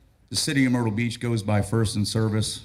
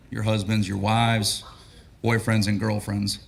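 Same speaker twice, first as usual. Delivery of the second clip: ordinary talk, nothing marked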